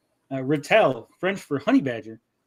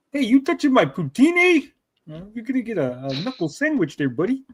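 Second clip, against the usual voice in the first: mock Canadian accent; 5 semitones higher than usual. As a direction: threaten in a hammed-up Canadian accent. Voices Canadian character in artwork.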